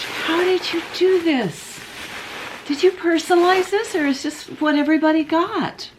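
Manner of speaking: unwrapping a Christmas gift, thrilled and gushing; real excitement. Real, not acted. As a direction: squeal over the gift with real joy. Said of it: "Oh, I'm so excited".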